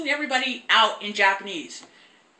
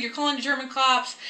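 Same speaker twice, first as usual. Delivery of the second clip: ordinary talk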